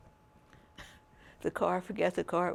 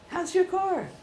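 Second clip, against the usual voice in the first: high, louder mother's question; 12 semitones higher, 6 dB louder than usual. Retold or imitated. Imitating mother asking the son about his car. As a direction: echo her mother's nosy question. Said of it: "from my mother".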